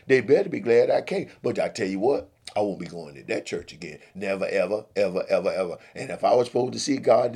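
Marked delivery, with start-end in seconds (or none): 0.1-1.3 louder, offended boast; huffy. Acted. Billy's indignant rant. 4.1-5.8 stamp each ever down; emphatic vow. Acted. Vowing never to return.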